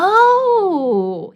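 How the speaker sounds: high, loud dawning oh; student's sudden realization, pitched high; surprised oh. Retold or imitated. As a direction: let the realization hit.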